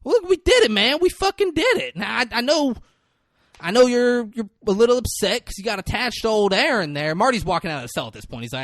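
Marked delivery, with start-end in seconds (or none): 0.0-7.1 high, loud, insistent pleading; acted upset. He acts out the upset client calling after Marty, insistent. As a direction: push it out high, easing down by the end. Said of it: "he's upset. And he's like".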